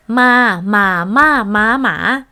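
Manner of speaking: "ma" repeated, each on a different tone; lexical tone demo. Shown as a demonstration. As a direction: show contrasting Thai-style tones on "ma". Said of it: "different tone, different meaning".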